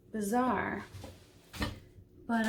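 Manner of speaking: ordinary talk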